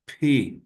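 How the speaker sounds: "Pi" with a puff of air after the P; aspirated P. Shown as a demonstration. Teacher demonstrating pisi to Aymara learners, aspirated P. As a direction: release the P of "Pi" with an audible breath.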